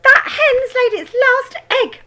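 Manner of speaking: high, loud, slow crone voice; shouting grandma. A narrator's reading. Grandma shouting from the roof about the hen. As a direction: call it down with relish. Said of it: "Grandma shouted".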